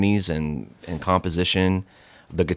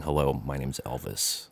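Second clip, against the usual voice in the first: boringly; 4 semitones lower, 10 dB quieter than usual. Retold or imitated. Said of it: "me just sort of boringly saying".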